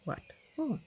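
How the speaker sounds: high, rising astonished question; the voice can't believe it. A narrator's reading. Hidden voice behind the door, astonished. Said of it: "more astonished than ever".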